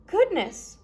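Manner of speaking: a touch of shocked concern; surprised goodness. Acted. Kind judge reacts to the children cooking. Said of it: "Justice Strauss said".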